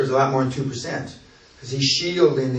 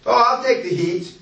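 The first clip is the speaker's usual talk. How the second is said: mock bravado; 9 semitones higher than usual. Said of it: "he's got no fucking experience".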